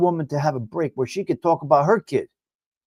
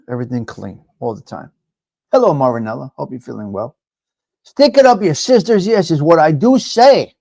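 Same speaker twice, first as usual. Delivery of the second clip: usual speaking voice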